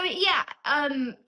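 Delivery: normal talking voice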